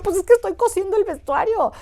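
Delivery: high, innocent explaining voice; naive student reply. Retold or imitated. Retelling her student self answering the director, high and earnest.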